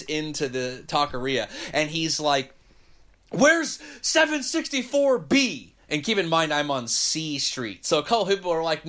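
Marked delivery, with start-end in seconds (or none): 3.3-5.7 high, slow demanding bark; angry old man. Retold or imitated. Old man demanding the address in a slow high bark.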